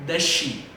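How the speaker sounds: "Does she" said in one go, the s melting into sh; linked sounds. Shown as a demonstration. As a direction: join "Does she" as "duh-she". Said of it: "de uma vez só". ("Does she" falado de uma vez só)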